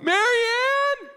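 put-on a grown man calling his wife like a small boy; childlike yell. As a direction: holler "Marianne!" like a helpless five-year-old. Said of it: "I probably sounded like a five-year-old little boy".

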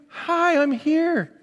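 high, slow, cutesy greeting; a weed popping up to say hello; cheeky weed voice. Pretend.

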